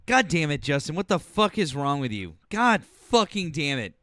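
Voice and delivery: loud angry shout, pitch thrown up high; performed yell on request. Pretend. S0 yells at Justin on request, furious fake rant. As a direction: yell at Justin like you mean it. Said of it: "yell at me, please".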